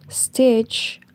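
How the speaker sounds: usual speaking voice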